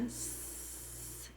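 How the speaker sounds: long, soft "sss" like a little snake; snake hiss. Shown as a demonstration. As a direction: hold a sustained hissing S. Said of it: "o S que faz o barulho da cobrinha". (chiado longo de cobrinha, "sss")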